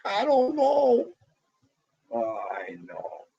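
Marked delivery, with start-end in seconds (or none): 0.0-1.1 high, slow, put-on innocent voice; mock innocent. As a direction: play dumb in a high drawn-out voice. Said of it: "I'm like".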